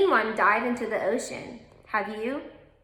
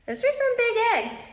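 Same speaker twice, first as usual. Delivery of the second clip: higher, quick burst of wonder at the picture; amazed; 11 semitones higher than usual. Real, not acted. Teacher marvels at egg picture.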